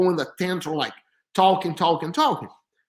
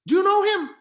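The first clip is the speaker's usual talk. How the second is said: high, loud, surprised ask; a retold double-take, higher and louder; surprised question; 13 semitones higher, 4 dB louder, faster than usual. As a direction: pitch it up in disbelief. Asking coach, surprised.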